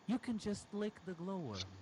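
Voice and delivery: put-on girlfriend's voice. Girlfriend's line voiced in story.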